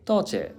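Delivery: "toche" said high, falling in Cantonese tone. Shown as a demonstration. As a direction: start "toche" high and let it fall. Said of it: "the second form of the Cantonese".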